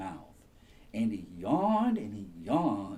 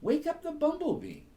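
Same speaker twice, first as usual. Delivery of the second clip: much higher, bright and chirpy; squirrel voice; 4 dB louder, faster than usual. Acted. Squirrel telling the rabbit to wake, high and quick. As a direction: squeak it out like a squirrel. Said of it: "said the squirrel".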